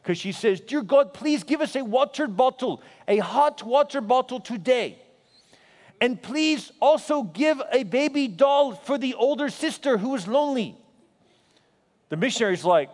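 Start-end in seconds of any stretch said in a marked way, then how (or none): 0.6-10.8 child's specific prayer voiced in a Zaire accent. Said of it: "in the beautiful accent of Zaire".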